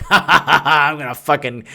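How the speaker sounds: gloating mock evil laugh; villain laugh. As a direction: cackle like a hunter spotting prey. Predator gloating over prey.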